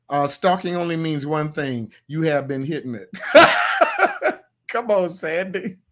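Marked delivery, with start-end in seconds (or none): 3.1-4.4 squealing laugh, much higher, louder; high burst of laughter. Real, not acted. Laughs at his own joke.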